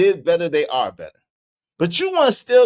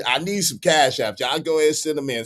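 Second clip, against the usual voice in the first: usual speaking voice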